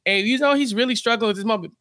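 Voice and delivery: ordinary talk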